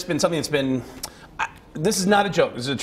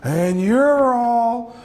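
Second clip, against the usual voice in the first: very slow, building to a huge shout; Al Pacino; 8 semitones higher, 5 dB louder, much slower than usual. Acted. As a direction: stretch the words, then explode on the last ones. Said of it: "as Al Pacino".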